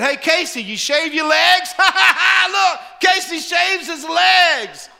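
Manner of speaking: high, loud jeering schoolboy call; mock him across the gym, shouting and laughing; mocking bully taunt. Schoolboy taunting a kid in PE class, loud jeering. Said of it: "And he said".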